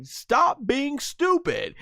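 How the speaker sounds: high, slow mock Bulma scold. Bulma scolding Vegeta mid-fight, high slow mock voice. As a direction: scold him slowly from up high. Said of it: "she's like".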